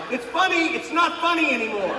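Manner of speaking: wife losing patience; exasperated shout. Retold or imitated. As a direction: yell from the kitchen fed up. Wife yelling from kitchen.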